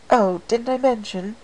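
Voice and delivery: a hint of an elegant fashionista; lightly voiced Rarity.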